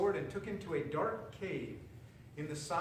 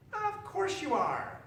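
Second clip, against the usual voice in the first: high, slow, sly witch; 11 semitones higher, 4 dB louder, slower than usual. A narrator's reading. Witch fooling the frog, high and slow. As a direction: purr the lie high and slow. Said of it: "said the witch".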